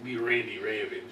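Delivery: badly faked Macho Man; hammed-up wrestler voice. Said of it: "that was terrible".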